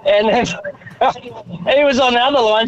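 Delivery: ordinary talk, nothing marked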